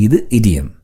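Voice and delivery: ordinary delivery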